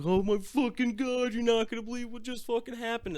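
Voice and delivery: high, fast, breathless burst of news; excited disbelief. Retold or imitated. Telling friends on the porch about the arrest, high frantic rush. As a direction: blurt it out all at once, way up high.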